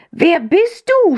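high, drawn-out indignant cry; angry cry. A narrator's reading. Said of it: "schrie er". (zorniger, hoher Aufschrei)

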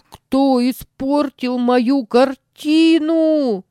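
high, slow tearful wail; crying. A narrator's reading. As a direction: cry it out like a hurt boy. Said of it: "заплакал он".

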